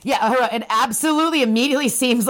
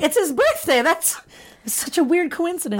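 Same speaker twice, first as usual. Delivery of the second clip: mock amazement; 7 semitones higher than usual. Acts her naive belief.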